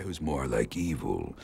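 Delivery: low, slow villain voice; evil schemer. Shown as a demonstration. Actor introduces his villain with a plan, evil voice. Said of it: "more like evil and has a plan".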